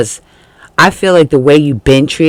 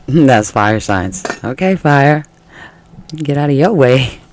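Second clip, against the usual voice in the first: ordinary talk, nothing marked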